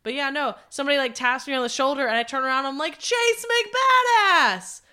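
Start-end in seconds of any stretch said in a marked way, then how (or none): 3.0-4.8 loud, high, drawn-out name shout; calling out. Retold or imitated. Storyteller turns and spots him at concert, calling out. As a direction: holler the name in recognition. Greets rediscovered friend at concert.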